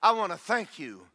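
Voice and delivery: rising, fervent thanksgiving prayer. Shown as a demonstration. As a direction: pray it out loud with full conviction. Demonstrates his morning prayer aloud.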